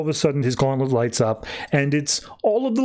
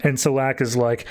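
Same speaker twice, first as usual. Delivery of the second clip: ordinary talk, nothing marked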